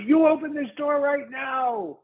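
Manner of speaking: high, loud threatening yell; intimidating shout. Acted. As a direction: bark the order through the door. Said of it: "attempt to intimidate the person".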